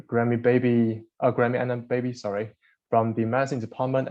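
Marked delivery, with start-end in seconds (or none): none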